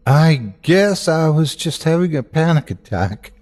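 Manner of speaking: a forced, smiling brush-off; fake smile. A narrator's reading. Jensen hiding his panic from Dr. Prescott, forced smile. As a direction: smile through it to hide the fear. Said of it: "putting on a fake smile".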